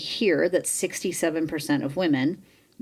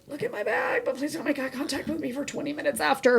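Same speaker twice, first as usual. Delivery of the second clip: high, softer, squirming plea; embarrassed plea; 9 semitones higher, 4 dB quieter than usual. Retold or imitated. Asking husband to look at her bag, high embarrassed plea. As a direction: beg him with mortified embarrassment.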